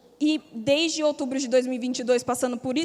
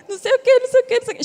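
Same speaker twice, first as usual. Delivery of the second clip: crying too; 10 semitones higher, 6 dB louder, much faster than usual. Retold or imitated. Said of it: "Chorava também".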